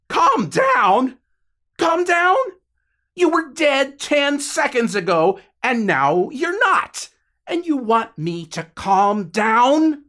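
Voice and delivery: high, loud disbelieving yell; incredulous shout. Acted. Jonah screaming at his friend who just came back from the dead. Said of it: "almost choked on his own scream".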